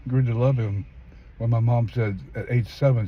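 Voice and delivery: normal talking voice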